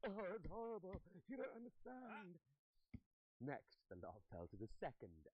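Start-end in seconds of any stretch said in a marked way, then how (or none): 0.0-2.4 high, loud, distraught gasping; hammed-up traumatised cry; gasping horror. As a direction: wail it high between gasps. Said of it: "gasping on the".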